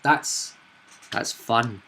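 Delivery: usual speaking voice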